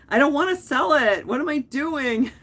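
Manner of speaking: high, loud playful wail over keeping the dress; excited. Real, not acted. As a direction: squeal as if you can't bear to part with it. Torn over selling dress.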